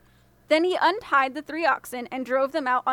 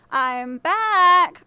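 a loud, higher, cheerful call; 4 semitones higher, 8 dB louder than usual. Acted. The boy calling to the thieves as he walks in, loudly. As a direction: announce yourself from the doorway. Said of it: "called the boy as he walked in".

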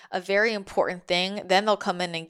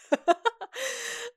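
high laugh. Acted. Guides laughing at someone who calls it coincidence, high laugh. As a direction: burst of high-pitched laughter.

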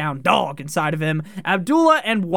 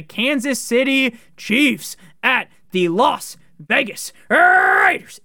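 announcer hype; 6 semitones higher, 3 dB louder, much slower than usual. Acted. Host announcing the Raiders game to listeners, slow loud showman hype. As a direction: belt it out like a Vegas emcee.

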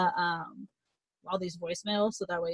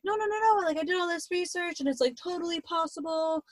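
put-on high, eager pleading girl; a light voicing of a desperate girlfriend; pleading Lou; 9 semitones higher, 4 dB quieter than usual. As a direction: beg him to stay, voice up high.

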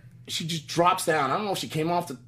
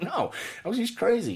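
high scared quote; 8 semitones higher than usual. Retold or imitated. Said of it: "I was like".

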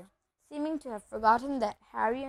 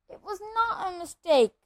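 higher, heated retort; angry; 8 semitones higher, 4 dB louder than usual. Acted. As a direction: snap back angrily. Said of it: "said Harry angrily".

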